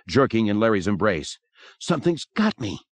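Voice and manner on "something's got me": high, startled exclamation; alarmed cry. A narrator's reading. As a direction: cry out as if grabbed. Yelping as something grabs her.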